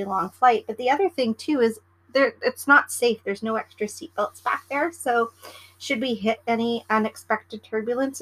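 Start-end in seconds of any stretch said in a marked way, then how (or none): none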